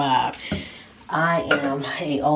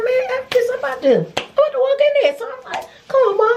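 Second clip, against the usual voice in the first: much higher, defiant exasperation; fed up; 3 dB louder than usual. Retold or imitated. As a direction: storm past the door. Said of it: "So I was just like".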